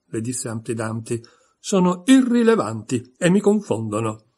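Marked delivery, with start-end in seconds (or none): 1.6-4.2 irritated. Acted. Humpty annoyed at interruptions.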